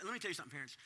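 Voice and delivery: ordinary delivery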